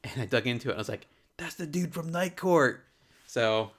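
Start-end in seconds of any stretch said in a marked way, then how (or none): 1.4-2.9 higher, drawn-out retold discovery; excited realization. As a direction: replay the moment it clicked, stretching it out. Retells the moment of recognizing him.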